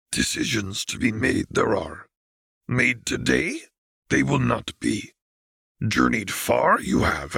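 put-on old Jedi grandmaster addressing the council, thoughtful; Yoda voice. A narrator's reading. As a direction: wise little master, musing kindly.

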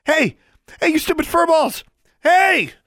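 very high, loud urgent yelling; screaming. A narrator's reading. Girl trying to regain panthers' attention, screaming. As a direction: scream insults to get their attention. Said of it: "Alexandra screamed even more loudly".

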